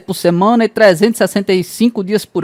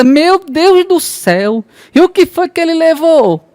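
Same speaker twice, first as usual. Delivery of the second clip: much higher, very slow panicked cry; desperate mother; 8 semitones higher, slower than usual. Acted. Mother panicking on hearing of a burglar, high desperate cry. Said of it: "A mãe desesperada pergunta".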